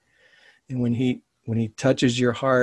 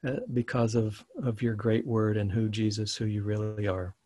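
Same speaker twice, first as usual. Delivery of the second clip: closing prayer. Acted. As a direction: pray humbly and close to the mic.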